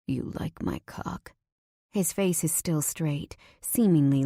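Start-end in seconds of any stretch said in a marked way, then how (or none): 0.1-1.3 lower, whispered man's question, very soft; low whispered male voice. Acted. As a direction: ask it in a low, hushed whisper. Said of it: "He speaks".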